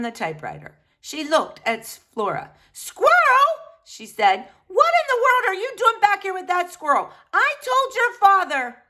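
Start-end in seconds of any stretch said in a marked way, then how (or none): 2.7-3.5 high, loud cry of the name; mother shouting at Flora on seeing the squirrel; startled shout. Acted. 4.7-8.8 high, loud scolding mother voice; a shocked mother, higher and louder; exasperated mother. Acted.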